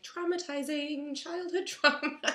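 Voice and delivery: high, loud mock horror, laughing through it; loud and high, cracking up. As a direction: laugh while saying traumatizing. Imagined son's thought, laughing.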